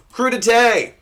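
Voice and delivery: put-on high, punched word in a French accent; mock French accent. Comic announcing a veggie platter, mock French accent. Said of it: "apologize in advance for my French accent".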